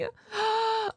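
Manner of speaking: sharp gasp. Real, not acted. Reacting to video reveal.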